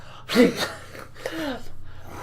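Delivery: soft, high breathy giggle; a quiet high laugh. Real, not acted. Laughs at favorite trap.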